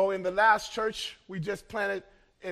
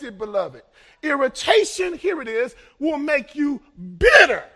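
loud, rising preacher's call to the congregation; full-force preaching that climbs on each example; 3 semitones higher, 8 dB louder than usual. Acted. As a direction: hammer each example home.

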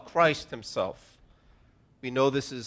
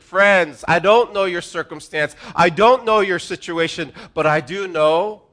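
louder, urgent call to the room; preaching appeal; 3 semitones higher, 7 dB louder than usual. Real, not acted. Pastor appealing to his friends, louder. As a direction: plead with the congregation.

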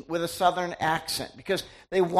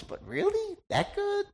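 much higher, mock-doubtful "really? that good?"; incredulous; 14 semitones higher, 5 dB quieter than usual. Real, not acted. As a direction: pitch it up in playful disbelief. Doubting how good BTS is.

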